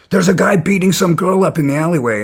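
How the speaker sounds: mock the alarmed passer-by calling for help; retold alarm. Bystander raising alarm in retold story.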